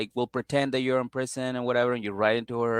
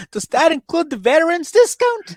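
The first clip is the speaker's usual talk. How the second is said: much higher, louder mocking cheapskate voice; 9 dB louder than usual. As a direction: squeak it out like a stingy bargain hunter. Mocking EJ's cheap message.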